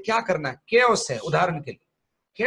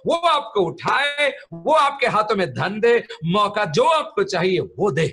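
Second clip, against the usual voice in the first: rising, emphatic sermon cadence over music; fervent preaching; 3 semitones higher, 3 dB louder than usual. Acted. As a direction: preach it with building fervour.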